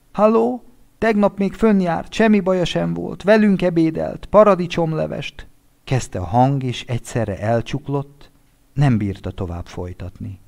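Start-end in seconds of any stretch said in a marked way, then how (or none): 0.1-5.5 high, loud shaken widower on the phone; panicked husband calling a friend, high and loud; distraught friend. Acted. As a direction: plead with him to come at once, high and frantic. Winter before breaking down.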